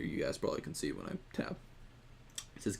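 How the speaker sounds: usual speaking voice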